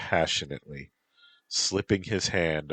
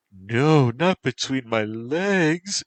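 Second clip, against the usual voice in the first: high, loud, drawn-out protest; 9 semitones higher, 6 dB louder, slower than usual. A narrator's reading. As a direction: cry out in theatrical protest. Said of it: "she protested".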